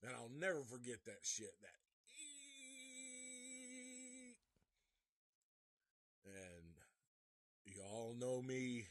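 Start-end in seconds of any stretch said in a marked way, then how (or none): 1.6-4.4 very high, thin imitated screech; a piercing high squeak like nails on a chalkboard; nails on blackboard screech. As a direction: mimic nails dragging on a chalkboard. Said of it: "he scratches the fucking blackboard".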